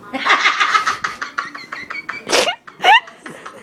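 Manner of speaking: breathless high-pitched giggle; laughing at the memory of the scene; high airy laugh. Real, not acted. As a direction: burst into a high wheezing laugh. Describing Ben's long drinking scene.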